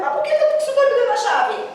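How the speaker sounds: high, quick disbelieving question; alarmed protest. Retold or imitated. Pastor objecting to being handed a stranger's key, alarmed. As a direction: question it in a high-pitched rush.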